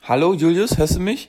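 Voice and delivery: laut und hoch rufen, als ob die Leitung hakt; laut ins Handy rufen bei schlechter Verbindung; gespielter Ruf ins Telefon. Pretend. Spielt hakende FaceTime-Verbindung vor. (laut rufend, als ob die Verbindung abbricht)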